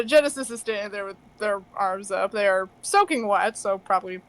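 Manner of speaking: ordinary delivery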